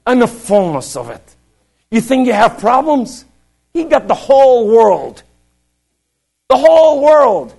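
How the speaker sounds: high, loud pulpit proclamation; forceful preaching. Acted. Preacher exhorting the congregation about God's power, high and forceful. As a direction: preach it out, punching each phrase high.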